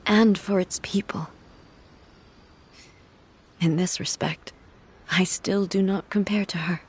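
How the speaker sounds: hushed, breathy whisper throughout. Acted. Grieving sister confides her memories in a whisper. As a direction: whisper it like a private confession.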